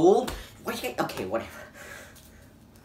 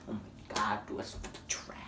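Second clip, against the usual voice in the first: soft breathy whisper of dismay; whispered disgust; 9 semitones lower, 15 dB quieter than usual. Real, not acted. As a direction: whisper it, appalled.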